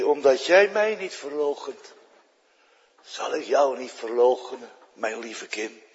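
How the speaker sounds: zacht en teder, wegstervend. Retold or imitated. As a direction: troostend als tegen een kind. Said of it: "toen zei hij". (Jezus troost de stervende vrouw: zacht en teder)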